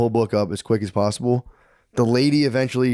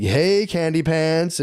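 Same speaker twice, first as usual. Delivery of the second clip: slow, higher, teasing sing-song greeting; drawn-out flirty call at the door; a lightly voiced flirt over the intercom; flirty neighbour voice; 6 semitones higher, much slower than usual.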